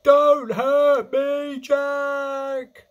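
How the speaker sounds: slow, high, loud plea; yelling. A narrator's reading. Dreaming giant pleading not to be hurt, loud yell. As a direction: wail it out, drawing the words long. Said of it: "he yelled".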